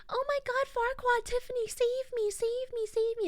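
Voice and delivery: high, wailing cry for help, imitating Maria; mock pained wail. Maria in pain begging Tiffany and Farquaad, high wailing plea. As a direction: squeal it out like you're in agony. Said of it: "'cause she's like".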